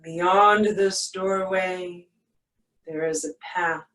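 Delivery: ordinary talk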